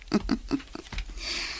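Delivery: soft, voiceless chuckle; quiet run of short puffed laughs; sly chuckle. Acted. Chuckles alone after he leaves.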